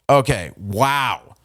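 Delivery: ordinary talk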